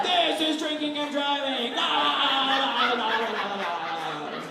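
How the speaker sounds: hammed-up dad cracking open a forty; rowdy dad whoop. Dad showing his son real drinking and driving, whooping. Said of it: "he's like".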